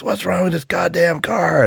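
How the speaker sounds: high-pitched mock angry yell; agitated gunman. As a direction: act out an exasperated robber losing it. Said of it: "he gets really agitated".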